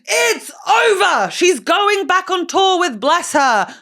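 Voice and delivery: high, loud, drawn-out announcement; hyped-up shout. Pretend. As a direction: belt out the tour news like a hype act. Hyped tour plug.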